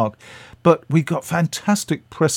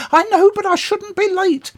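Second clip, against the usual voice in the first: much higher put-on voice of an elderly actress, worrying about being late; Patricia Hayes; 4 dB louder than usual. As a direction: pitch it way up and fret. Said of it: "and she said".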